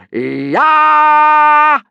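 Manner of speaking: very high, very loud battle shout; war cry yell. Acted. Viking teacher yelling the war cry for boys. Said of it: "yelled Gobber the Belch".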